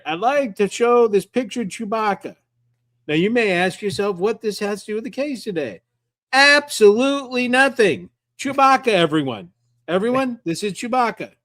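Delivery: high, hammed-up cartoon lawyer voice. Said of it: "gonna use a Chewbacca defense".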